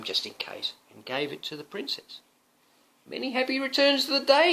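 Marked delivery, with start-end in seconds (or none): 3.1-4.5 knight's greeting. Acted. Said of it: "he said".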